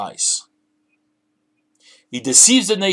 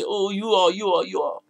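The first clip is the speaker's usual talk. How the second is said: mildly scared antelope, higher at first; shaking antelope; 4 semitones higher than usual. Retold or imitated. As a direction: imitate a timid antelope giving in. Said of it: "And the antelope shakes".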